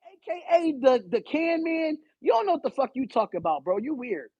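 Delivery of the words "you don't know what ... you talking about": ordinary talk, nothing marked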